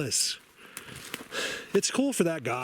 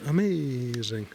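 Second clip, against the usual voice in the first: slow, stretched Italian amazing; Italian accent; much slower than usual. Retold or imitated. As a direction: drawl it like an Italian friend. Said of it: "that's how Alessandro says".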